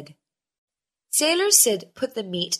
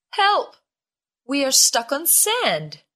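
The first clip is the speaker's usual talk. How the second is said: cry for help; 5 semitones higher than usual. A narrator's reading. Sailors wake stuck on sand.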